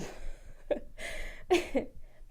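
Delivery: high unvoiced burst of laughter; breathy laugh. Real, not acted. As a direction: laugh it off, almost silent. Laughs at own story.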